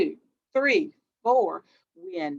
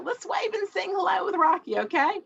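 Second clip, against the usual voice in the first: high cheerful voice; 9 semitones higher than usual. Acted. Singing hello song.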